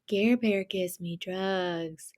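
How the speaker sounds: slow, soft, dopey drawl; drugged patient. Retold or imitated. Sedated patient repeating it over and over, dopey drawl. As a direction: drawl it out slowly as if heavily sedated. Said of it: "I just kept repeating over and over".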